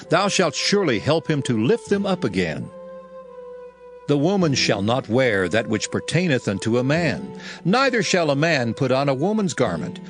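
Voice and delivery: ordinary delivery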